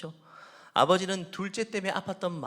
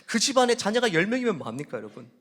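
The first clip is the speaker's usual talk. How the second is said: ordinary talk, nothing marked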